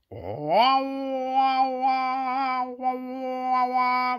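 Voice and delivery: high, loud wailing cry; wailing creature. Acted. Creature in the shed doorway, high loud wailing. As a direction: wail like a crying baby creature. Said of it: "went the creature".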